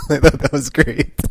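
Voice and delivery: loud, high, slow words through laughter; helpless laughing as you say it; real laughter. Real, not acted. Fan delighted by the comic scene, laughing hard.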